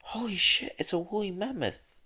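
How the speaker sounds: excited shout. A narrator's reading. Student in the crowd shouting at the mammoth. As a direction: yell it in disbelief at the mammoth. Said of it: "shouted someone from the crowd".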